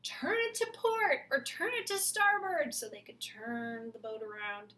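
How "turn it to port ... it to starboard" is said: sailor calls. Acted. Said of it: "some were saying".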